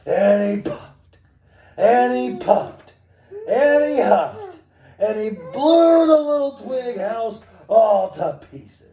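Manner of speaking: loud, high, drawn-out narration; building storybook bellow. A narrator's reading. Parent reading the wolf blowing down the house, building bellow.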